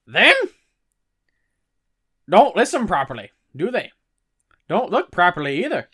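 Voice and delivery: Stan the conductor. Acted. Stan explains to Harry why Muggles never notice, loud and cheeky. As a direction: brush off the Muggles like a know-it-all. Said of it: "Stan hits him with this explanation".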